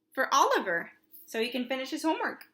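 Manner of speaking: ordinary delivery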